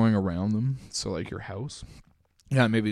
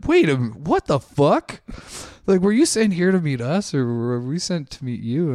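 astonished questions; 6 semitones higher, 8 dB louder than usual. Retold or imitated. Retelling strangers' astonished questions.

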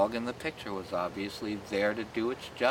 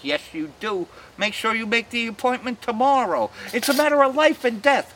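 rising higher and louder to a near shout; mock urgent; 13 semitones higher, 6 dB louder than usual. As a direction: build it into a dramatic plea. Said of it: "I was like".

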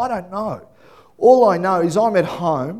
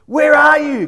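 high, loud call; a loud high searching call; 9 semitones higher, 8 dB louder than usual. Acted. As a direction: call out into the garden. God calling out again.

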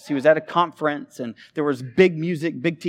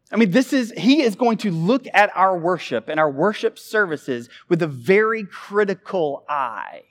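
ordinary talk

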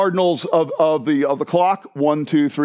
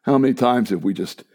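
prayer; 8 semitones lower than usual. Acted. Pastor leads closing prayer.

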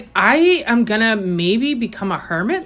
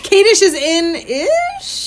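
high laughing squeal; 9 semitones higher, much slower than usual. Pretend. Squealing echo.

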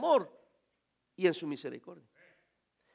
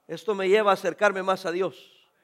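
ordinary delivery

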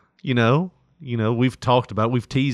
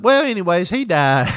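mom's voice; 7 semitones higher, 5 dB louder than usual. Retold or imitated. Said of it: "she's like".

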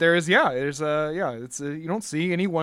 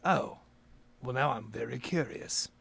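quiet Mister Holder; 3 semitones lower, 7 dB quieter than usual. Acted.

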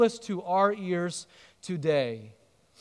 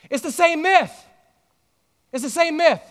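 preaching emphasis; 6 semitones higher, 4 dB louder than usual. Real, not acted. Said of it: "preacher repeats for emphasis".

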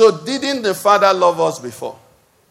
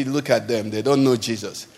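mock disdain; 8 semitones lower, 7 dB quieter than usual. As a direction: lower and softer, a sniffy looking-down. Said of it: "you say".

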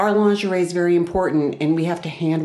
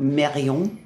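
name said the French way; lightly put-on French pronunciation; French accent. Shown as a demonstration. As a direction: give it a French nasal ending. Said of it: "say it in a French accent".

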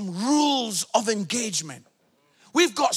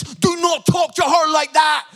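loud, high-pitched fatherly reprimand; acting out an angry dad confronting his son; in-his-face telling-off; 5 semitones higher, 6 dB louder than usual. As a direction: lay down the law, loudly. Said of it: "I was in his face".

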